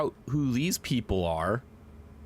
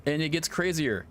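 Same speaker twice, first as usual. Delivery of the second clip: ordinary delivery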